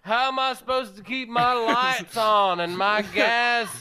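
indignant Southern hairdresser, slow and drawn out; Merle in character. Acted. Comic telling his role-play as Southern hairdresser, indignant slow character voice. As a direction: stretch it out like an aggrieved hairdresser. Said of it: "I have to get into character".

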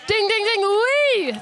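high, excited mock bell; winner's bell. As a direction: ring out the dings like a game-show bell. Host imitates correct-answer bell.

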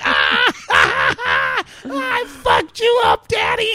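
high laughing yelps and taunt. Retold or imitated. Dad voicing his daughter gloating, laughing hard. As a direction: crow it out through laughter. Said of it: "she's like".

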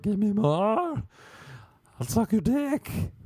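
high, drawn-out desperate begging; hammed-up pleading addict; imagined heroin user. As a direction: beg for a fix, stretching the words high. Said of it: "That's what I imagine heroin users say".

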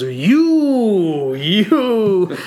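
high, drawn-out mock accusation easing into a vow; hammed-up defiance. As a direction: act out a king suddenly rebelling. Arshak turns defiant on home soil.

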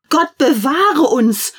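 high, loud and slow alarmed shriek; frightened cry. Acted. Maids crying out as the tree catches fire, shrieked. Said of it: "schrien die Hausmädchen".

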